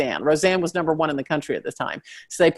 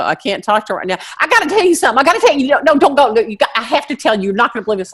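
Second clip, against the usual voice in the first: chatty Southern friend voice, rising higher; Southern girlfriend spilling news; Southern accent; 6 semitones higher, 4 dB louder, faster than usual. Retold or imitated. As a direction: gossip breathlessly in a Southern accent. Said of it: "you start getting that accent".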